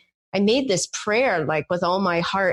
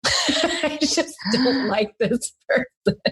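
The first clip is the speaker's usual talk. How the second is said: laughing; 6 semitones higher, slower than usual. Retold or imitated. Laughing at her own confession.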